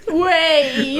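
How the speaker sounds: half laugh, half protest; laughing wait. Real, not acted. Date partner stops cohost mid-advice, laughing.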